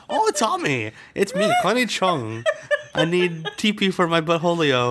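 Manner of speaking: high, mock prank-call voice; prank-call the house as a fake Connie Chung; sister doing Connie Chung. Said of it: "She'd be like".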